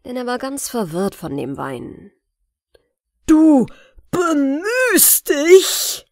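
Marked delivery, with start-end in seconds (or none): 3.3-6.1 loud, high, slow furious yell; angry shout. Acted. The tyrant screams at the dwarf in fury. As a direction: scream it back in rage. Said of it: "schrie der Tyrann wütend".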